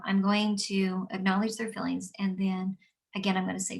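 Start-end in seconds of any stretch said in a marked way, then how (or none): none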